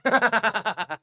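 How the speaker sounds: a sudden shouted laugh. Real, not acted. Teacher bursts out laughing.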